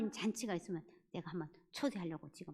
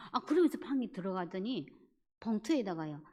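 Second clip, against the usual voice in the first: ordinary talk, nothing marked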